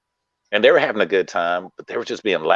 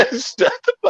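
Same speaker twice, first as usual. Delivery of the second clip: laughing. Retold or imitated.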